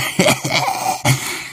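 short breathy bitter laugh; forced laugh. A narrator's reading. Drunkard laughs at his own shame, forced. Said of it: "zaśmiał się".

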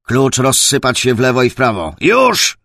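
loud, high-pitched combat command; urgent command, peaking on the last word; shouted order. Acted. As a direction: order the squadron to scatter at once. Captain orders squadron to scatter.

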